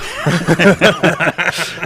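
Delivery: loud laughing burst, up high; laughing out loud at the guest's wife joke; real burst of laughter. Real, not acted. Laughing hard at the guest's joke about his wife.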